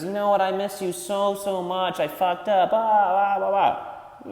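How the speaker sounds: high, whiny imitation of an ex begging to return; lightly mocking, high pleading voice; mock pleading girl. Ex-girlfriend begging to come back, mocked high and whiny. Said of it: "and then says".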